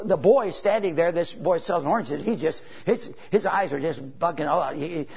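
usual speaking voice

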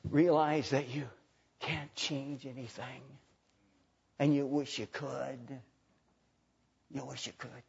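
soft, slow and low preaching; hushed, grave. Real, not acted. Preacher tells friend change is impossible, slow hushed gravity. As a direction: let it sink slowly almost to nothing.

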